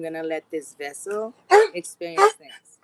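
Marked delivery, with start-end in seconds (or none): 1.7-2.8 sudden loud outburst. Real, not acted. Sudden shift to dog.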